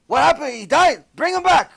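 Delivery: high urgent cry of alarm; alarmed outcry. Acted. Rabbi hears his student died and cries out in alarm. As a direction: demand him back right now.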